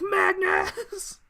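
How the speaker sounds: high, strained dramatic scream of a name; acted horrified yell; anguished cry. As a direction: cry out as your friend is stabbed. Acted cry as friend is stabbed.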